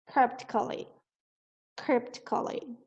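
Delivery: cryptically com o P claro. Shown as a demonstration. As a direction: repita cryptically pronunciando o P. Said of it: "a pronúncia correta é com o P". (pronúncia correta com o P)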